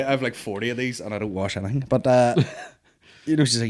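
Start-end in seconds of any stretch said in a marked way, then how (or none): none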